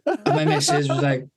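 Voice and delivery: ordinary delivery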